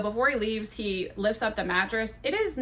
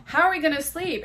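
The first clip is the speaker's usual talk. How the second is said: high-pitched worried wail; retold fretting, pitched way up; acted panic; 8 semitones higher, 3 dB louder than usual. Woman beside her broken bed, acting out panic.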